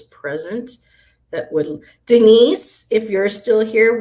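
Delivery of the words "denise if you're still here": calling out. Real, not acted. Calling out to a viewer.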